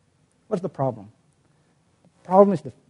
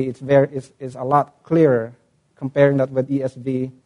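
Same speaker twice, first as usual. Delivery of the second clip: normal talking voice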